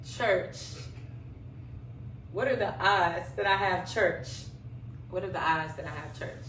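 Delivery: normal talking voice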